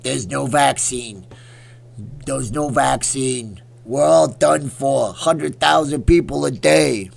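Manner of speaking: loud, very slow doom-laden doctor impression; Dr. Fauci. Streamer mimicking the doctor's dire warnings, hammed-up impression. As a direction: drag out each word in a booming mock warning. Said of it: "Fauci impression on fleek".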